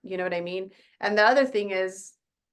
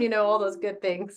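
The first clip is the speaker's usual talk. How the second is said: ordinary delivery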